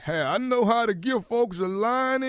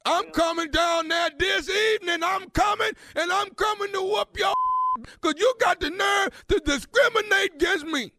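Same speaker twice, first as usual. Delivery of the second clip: higher, heated mock-angry threat; 5 semitones higher than usual. Prank caller warning the barber he is coming over, angry threat. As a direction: threaten him as if you mean it, voice pushed up.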